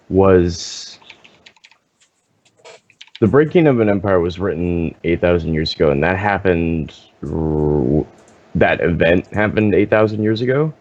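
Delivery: usual speaking voice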